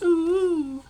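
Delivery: high squeaky ooh; a sudden high ooh of mock awe; squeaked surprise. Real, not acted. Reacting to notes.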